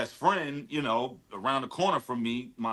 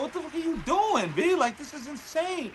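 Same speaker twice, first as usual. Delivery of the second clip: way higher, shocked best friend; stunned exclaim. Retold or imitated. Friend A stunned by the new album, high shocked exclaim. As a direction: yelp it in total disbelief. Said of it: "He's like".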